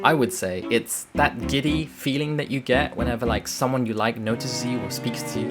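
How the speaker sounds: ordinary talk, nothing marked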